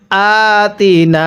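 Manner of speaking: আতিনা with long first and last aa; elongation. Shown as a demonstration. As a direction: chant আতিনা slowly, stretching "aa" and "naa". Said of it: "হামজায়ের মধ্যে টান দিব আর নুনের মধ্যে".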